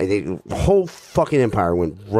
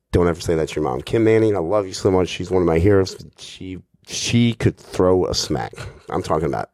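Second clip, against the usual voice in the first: ordinary talk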